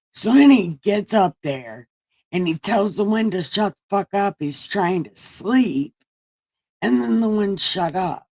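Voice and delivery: ordinary talk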